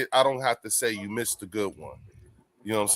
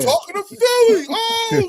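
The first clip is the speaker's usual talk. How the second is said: much higher, shouted fan reaction; imitating hyped yell; 7 dB louder than usual. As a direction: holler it like you're in the stands. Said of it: "he'd have been like".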